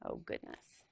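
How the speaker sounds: whispered "Oh, goodness"; hushed gasp. A narrator's reading. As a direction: breathe it out in alarm. Frightened aside to kids.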